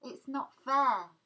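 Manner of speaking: slow, high, loud and upset; tearful protest. A narrator's reading. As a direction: plead it through tears.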